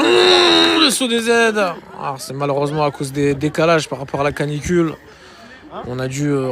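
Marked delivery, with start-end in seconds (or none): 0.0-1.6 excited shout. Acted. Sudden shouted call.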